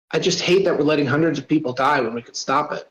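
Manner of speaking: ordinary delivery, nothing marked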